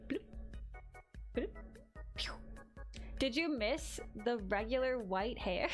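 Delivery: normal talking voice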